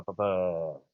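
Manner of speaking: normal talking voice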